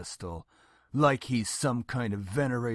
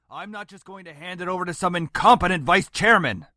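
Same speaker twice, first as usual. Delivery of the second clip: raised voice, higher and much louder; angry scolding; 5 semitones higher, 13 dB louder, faster than usual. Acted. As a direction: build to an indignant outburst. Father scolds, building in anger.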